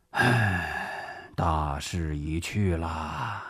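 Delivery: long sigh, then low, slow words; resigned sigh. Acted. General admitting the cause is lost, sighing resignedly. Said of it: "朱绍良叹了口气".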